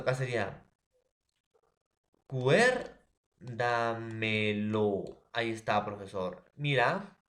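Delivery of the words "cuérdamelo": "cuérdamelo" con CUÉR fuerte y alargado, sílaba por sílaba; acento en CUÉR. Shown as a demonstration. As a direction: alarga mucho "cuérdamelo", cargando la voz en la primera sílaba, CUÉR. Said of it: "cuer es tras antepenúltima". (énfasis didáctico en la sílaba CUÉR de "cuérdamelo")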